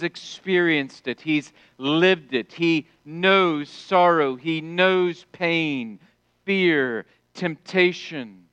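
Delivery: slow, weighty, each word leaned on; slow emphatic preaching. Acted. Preacher telling the congregation Christ knows their pain, slow and weighty. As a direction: hammer each word home slowly.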